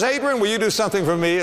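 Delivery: lightly voiced wife asking a favour; sweet coaxing wife. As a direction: coax him like a loving wife. Wife asks sweetly, second version.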